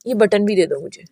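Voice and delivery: normal talking voice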